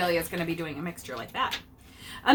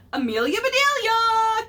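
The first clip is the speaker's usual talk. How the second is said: calling out; 13 semitones higher, 6 dB louder than usual. A narrator's reading. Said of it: "called Mister Rogers".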